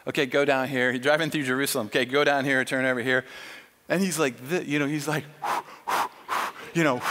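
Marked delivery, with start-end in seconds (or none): 0.1-0.9 low-pitched officer giving directions; curt directions. Acted. Israeli policeman to the driver, curt directions. 1.9-3.2 low-pitched officer giving directions; curt directions. Acted. Israeli policeman to the driver, curt directions.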